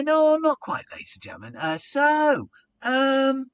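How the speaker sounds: high, loud stalling tease; teasing the crowd. Retold or imitated. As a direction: drag it out, keeping them hanging. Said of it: "So I'm sort of teasing them".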